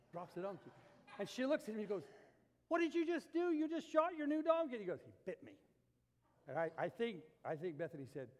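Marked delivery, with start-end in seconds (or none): 2.7-4.8 high, loud imitation of an incredulous wife; mock outraged outcry, pitched way up; scolding wife's astonished shriek, acted out; shocked wife. Wife shocked at shot donkey. 5.0-5.6 low, soft deadpan retort; understated excuse, dropped low; deadpan husband. Retold or imitated. Husband's meek excuse to wife.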